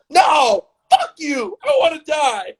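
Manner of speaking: loud, very high desperate shriek; hysterical screaming. Acted. Shot man refusing the young man, hysterical high screaming.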